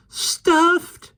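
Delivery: put-on high squeaky boy voice. A narrator's reading. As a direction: squeak it out in dismay. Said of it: "echoed Michael squeakily".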